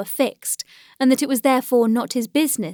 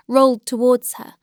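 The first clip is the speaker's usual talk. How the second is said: plain reading voice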